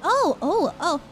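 usual speaking voice

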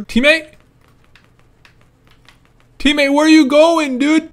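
high, loud questioning shout; calling out. Real, not acted. Player losing the teammate, exasperated calling out. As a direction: holler after someone leaving.

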